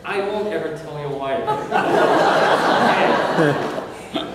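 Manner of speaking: breaking into laughter between the words; laughing reassurance. Acted. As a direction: giggle as you promise to keep it secret. Friend laughing, promising secrecy.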